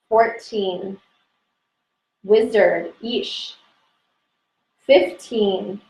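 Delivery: slow single words set apart by long pauses; meditative recitation. Real, not acted. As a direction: recite each name unhurriedly for closed-eyed listeners.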